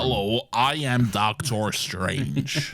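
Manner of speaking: slow, broad mock American accent; hammed-up American accent. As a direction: drawl it like a Brit faking America. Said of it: "Doctor Strange does his American accent".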